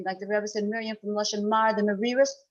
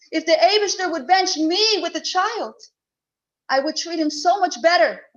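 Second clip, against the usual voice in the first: pleading through tears; 5 semitones higher, 5 dB louder than usual. Acted. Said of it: "she's crying and begging and pleading".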